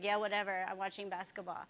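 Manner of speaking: low-key, uninterested brush-off; dismissive boyfriend. Retold or imitated. Teacher mimicking her boyfriend watching basketball, dismissive.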